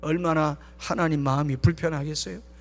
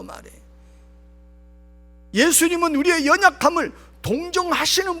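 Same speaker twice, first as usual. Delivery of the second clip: raised, higher preaching voice; emphatic preaching; 6 semitones higher, 6 dB louder than usual. Acted. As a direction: proclaim it to the congregation with force.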